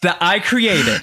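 high, drawn-out mock offense; indignant Jesus. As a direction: stretch each word in disbelief. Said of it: "Jesus was like".

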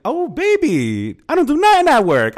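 high, loud gleeful brag; excited. Retold or imitated. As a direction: crow it out, delighted. Boasting about slacking at work.

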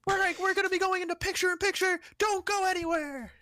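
very high parody commentator; hammed-up high commercial-break call; mock announcer shout. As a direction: urge viewers to stay in a squealed announcer yell.

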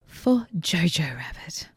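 slow, hushed, whispered reveal; dramatic whisper. Acted. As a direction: whisper it like a juicy secret. Dramatic reveal of big news.

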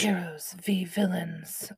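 hushed, awed whisper. Real, not acted. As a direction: breathe the title. Whispering dramatic title.

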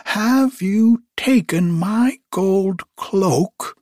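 high, slow storybook witch's demand; calling out. A narrator's reading. Witch calls after fleeing thief.

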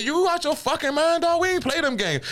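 very high-pitched mock outrage; indignant dismissal. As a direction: shoot the idea down with pitch flying up. Acted refusal to an order.

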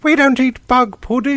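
high, prim and gently offended; offended British mom. Retold or imitated. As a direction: play the huffy British mum. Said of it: "she was like".

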